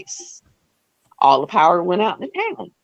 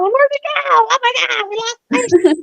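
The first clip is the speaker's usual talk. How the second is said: imitating a panicked nurse, much higher; high frantic alarm over a lost patient; hammed-up nurse panic, pitched way up; mock panicked nurse. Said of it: "Out there going".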